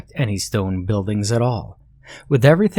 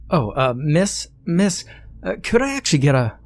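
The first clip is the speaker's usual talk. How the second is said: higher, hesitant call for attention; flustered calling out; 8 semitones higher than usual. Acted. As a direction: stammer out a polite call. Flustered scholar calling barmaid.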